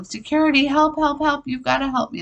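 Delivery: high-pitched, mock panicked cry; retold yell for help. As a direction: act out calling frantically for security. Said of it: "after yelling".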